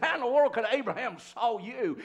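high, loud, fast jeering; loud sneering mockers; mocking crowd. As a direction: scoff at him like a scornful crowd.

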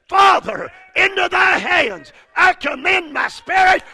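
a loud, high call to the Father; loud cry. Retold or imitated. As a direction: proclaim it with a loud voice, lifted high. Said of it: "With a loud voice".

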